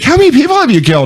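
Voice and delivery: high, quick, nosy girl imitation; mock girl at bar.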